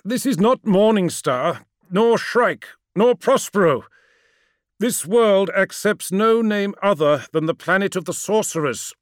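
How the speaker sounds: loud, pleading insistence; insistent. Acted. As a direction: call it out urgently to your lord. Ammon insists over the storm.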